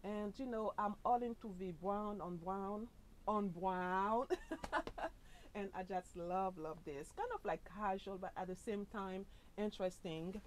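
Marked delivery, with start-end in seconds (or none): 4.3-5.5 high, light giggle; amused chuckle at yourself; laugh. Real, not acted. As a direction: burst into a short, high laugh. Amused at her own brown outfit.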